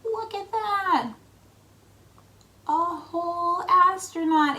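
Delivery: high slow wonder; surprised. Acted. Reader shows children the hidden astronaut in wonder. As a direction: marvel at the discovery. Reveal under the flap.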